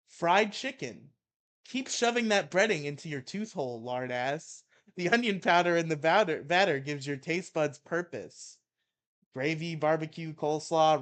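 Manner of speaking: loud mock-angry reading; ranting article read. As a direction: belt out the rant. Reads Maddox rant aloud.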